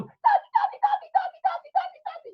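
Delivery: put-on very high, squealing little children; excited kids. Kids running to father.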